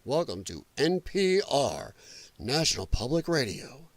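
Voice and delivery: soft, slow, low-key public radio voice; hushed and unhurried, parodying a radio host; mock NPR host. Streamer parodying NPR hosts greeting listeners, hushed and stuffy. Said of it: "They all talk like this".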